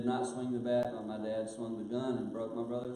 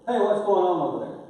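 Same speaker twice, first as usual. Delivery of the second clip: loud, high call from far off; booming call from the back corner; grandpa calling out; 8 semitones higher, 12 dB louder than usual. Retold or imitated. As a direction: shout across the yard like a distant grandpa. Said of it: "I hear this voice go".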